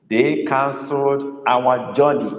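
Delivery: slow dictation. Shown as a demonstration. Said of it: "Let's go".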